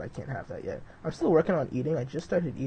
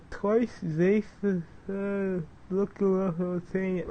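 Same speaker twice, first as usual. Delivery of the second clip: mumbled and higher, like a numb swollen mouth after surgery; slurred swollen-mouth speech; 6 semitones higher, slower than usual. Retold or imitated. Vlogger imitating how he sounded after wisdom teeth surgery, higher and mushy. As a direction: talk as if your cheeks are packed with gauze. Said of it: "Like, that's how I sounded".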